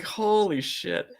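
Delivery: ordinary delivery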